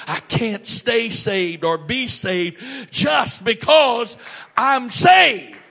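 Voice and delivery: loud, high climax, slow then peaking; full-force shouted climax; preaching shout. Real, not acted. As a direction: build to a shout on the last words.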